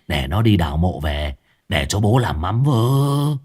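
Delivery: low, cackling henchman eagerly agreeing. Acted. Henchman Cà Rồng cackles along with his master. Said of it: "Thằng Cà Rồng cũng cười sằng sặc".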